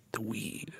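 barely audible, low and secretive; voice dropped down. Retold or imitated. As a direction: drop it to a near whisper. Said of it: "my voice goes down".

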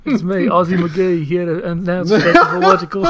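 put-on laughing through a jokey Australian accent; chirpy fake Australian, half laughing; Australian host announcing the show, laughing; hammed-up Australian accent. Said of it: "Australian is like a British accent that's curdled".

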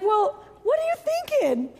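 high, slow, drawn-out disbelief; incredulous challenge. Retold or imitated. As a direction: question his sanity slowly. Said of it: "And I'm like".

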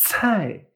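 cai as "tsʰai" with a strong puff of air; cai, breathy ts and falling tone; aspirated fourth-tone cai. Shown as a demonstration. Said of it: "que aquí saquemos aire".

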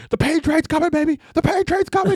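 very high hammed-up battle cry; Terry Tate bursting into the break room, mock shout. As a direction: roar it like a charging linebacker. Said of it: "He's like".

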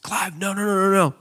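high, sudden no no no, loud; father warning his trapped son not to pull his finger free; urgent alarm. Retold or imitated. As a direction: stop him before he hurts himself. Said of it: "And I just said".

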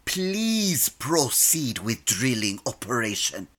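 grudging polite. Acted. Captain obliging the computer's request, grudging politeness. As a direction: say please through clenched patience. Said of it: "See? That wasn't so hard".

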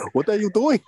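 high, loud mock Greek accent; Giannis's strong accent. Teasing the player's huddle rant to friends, extra-strong accent. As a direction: exaggerate a thick Greek accent, laughing through it. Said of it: "his accent is extra strong".

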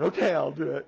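loud, sudden determined burst; bowed-up resolve. Retold or imitated. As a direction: imitate a daughter steeling herself. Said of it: "I could feel her bow up".

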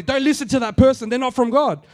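loud, preachy condemnation; mocking himself warning everyone off, loud and preachy; mock judgmental. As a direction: play the self-righteous judge. Mock self condemning others.

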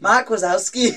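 booming hammed-up Scottish shout; Scottish accent. Asked to redo Boo as Scottish, comic Scottish accent. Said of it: "can you do that in the Scottish accent?".

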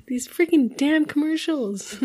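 retold grumble from a weepy dad; mock upset dad. Dad explains his tears over commercials, mock upset.

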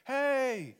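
high, loud call across a distance; calling out from afar. Retold or imitated. David calling to Saul across the valley, a loud high call. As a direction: hail someone far away. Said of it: "safe distance away and says".

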